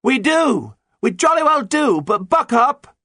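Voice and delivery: very high, loud impatient yell; shouting back. A narrator's reading. Pirate captain surrenders from the lookout, shouting back impatiently. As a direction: shout back down, urging them on. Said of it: "Nancy Blackett shouted back".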